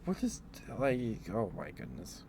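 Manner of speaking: ordinary talk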